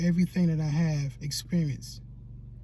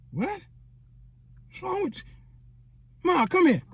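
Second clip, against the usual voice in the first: high-pitched disbelieving reaction; high, exasperated mimicry; incredulous sister; 11 semitones higher than usual. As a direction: act out a sister baffled by her brother.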